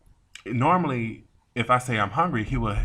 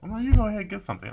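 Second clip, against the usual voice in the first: high fast retold refusal; 11 semitones higher, much faster than usual.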